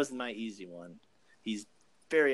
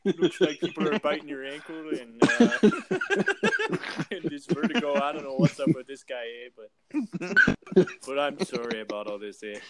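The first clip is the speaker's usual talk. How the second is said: hammed-up apologetic Canadian, laughing; Canadian accent; 4 semitones higher, 7 dB louder than usual. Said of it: "And then I'll be like".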